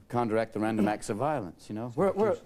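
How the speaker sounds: ordinary talk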